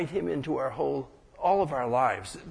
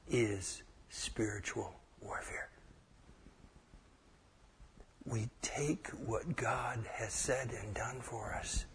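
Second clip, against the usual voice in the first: whisper; 4 semitones lower, 12 dB quieter than usual. Real, not acted. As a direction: drop to a whisper to draw them in. Whispers about spiritual warfare.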